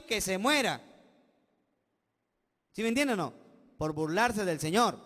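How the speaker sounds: loud, forceful preaching; loud pulpit delivery, pressing the point; fiery preaching. Acted. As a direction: hammer each question home to the congregation.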